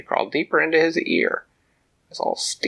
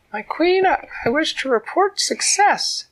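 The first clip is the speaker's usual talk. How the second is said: high, loud little ant voice; tiny ant reporting; 5 dB louder than usual. A narrator's reading. Ant reporting success to Queen Tila, high squeak. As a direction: report proudly like a small loyal ant.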